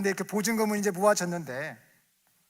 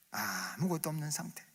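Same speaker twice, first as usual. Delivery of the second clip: ordinary talk, nothing marked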